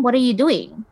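ordinary talk, nothing marked

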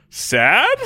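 high laughing question; can't believe the answer; laughing disbelief. Real, not acted. Surprised by a happy vote.

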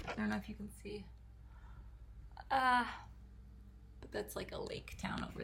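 soft unvoiced whisper. Real, not acted. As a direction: whisper it aside. Aside while pointing at view.

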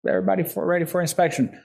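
mock call-out. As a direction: call it out like a cadet announcing inspection. Retold inspection call-out.

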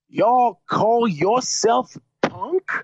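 put-on jerk-off accent. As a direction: drag it out like an obnoxious heckler doing an accent. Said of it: "I'm not doing the proper, um, accent".

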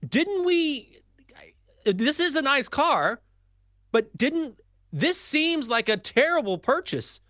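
high-pitched, halting disbelief; retold stunned protest. Retold stunned reaction to the car.

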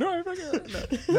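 mock high-pitched voice. Friend joining the new-house teasing, mock high-pitched squeal. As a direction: put on a squeaky, soft gush. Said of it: "their voice goes high pitched".

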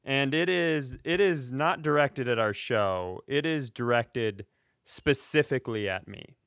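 normal talking voice

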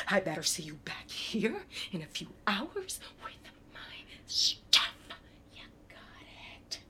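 hissed threat, under the breath; menacing whisper. Acted.

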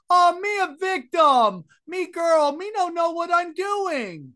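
a friend on a podcast mocks a scammer playing the victim, in a high, slow, broken accent; mocking broken-English victim. A friend on a podcast mocks a scammer playing the victim with a fake sob-story voice. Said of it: "now she's gonna cry like".

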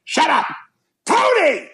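high mocking "Shut up, Tony"; mocking mother's retort. Mother mocking Tony at the table, high mimicking snap. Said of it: "he immediately looks at her and goes".

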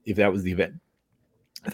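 talking through a swallowed bug; words caught in the throat after a bug; just swallowed a bug. Real, not acted. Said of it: "Sorry, I think I swallowed a bug or something".

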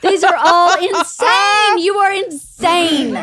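loud, high, drawn-out disbelief; retold incredulous cry; exasperated outburst. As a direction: stretch it out in exasperation. Mother exasperated at the fight.

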